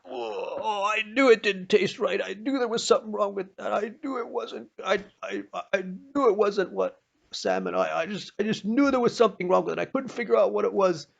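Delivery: high, loud, squeaky voice of a disgusted man; a high, loud, outraged complaint about the fish; disgusted husband. Retold or imitated. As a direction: whine it like a man who just learned he ate sucker. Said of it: "and he went".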